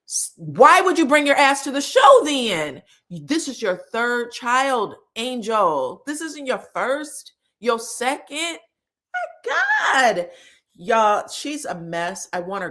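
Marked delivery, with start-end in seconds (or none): 0.1-2.8 loud, exasperated outburst; outraged. Real, not acted. Host scolding Angel on the show, loud exasperated outburst. 9.1-10.3 exasperated. Real, not acted. Exasperated exclamation.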